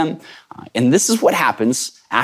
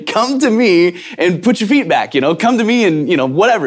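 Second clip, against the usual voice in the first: lightly voiced, higher and easygoing; casual imagined Jesus; 5 semitones higher than usual. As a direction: make it sound like a lazy, comfy invitation. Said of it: "I'm expecting him to say".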